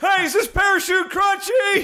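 high, slow barking sergeant; drill sergeant shout. Pretend. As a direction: yell like a drill instructor.

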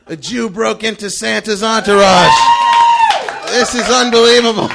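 loud, rising comic disbelief; astonished exclamation. Retold or imitated. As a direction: marvel out loud at your own gatecrash. Comic retold astonishment at gatecrashing.

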